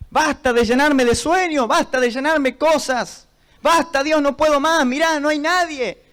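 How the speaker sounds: pleading with God, pitch climbing; retold fed-up protest in prayer; desperate complaint. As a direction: argue with God at wit's end. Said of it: "Así hablaba con Dios".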